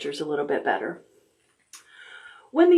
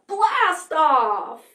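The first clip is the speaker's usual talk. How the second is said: excited blast off; 8 semitones higher than usual. Shown as a demonstration. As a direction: shout it like a rocket taking off. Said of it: "when we get to zero, we're gonna blast off".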